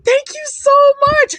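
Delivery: high, giddy gratitude; gushing thanks. Retold or imitated. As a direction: act out overwhelmed, thrilled thanks. Acting out gushing thanks when congratulated.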